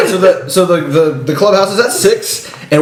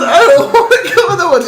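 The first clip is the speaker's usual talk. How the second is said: put-on high whining little boy; laughing through a high kid voice; whiny kid; 12 semitones higher than usual. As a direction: whine like a kid who refuses.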